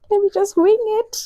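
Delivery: high laughing resolve; high amused squeal; squeaky laugh. Retold or imitated.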